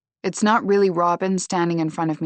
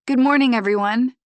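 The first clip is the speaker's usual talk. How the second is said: raised, bright call to a new group; teacher voice; 7 semitones higher, 5 dB louder than usual. A narrator's reading. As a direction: greet the class like a confident instructor. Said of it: "authoritative teacher voice".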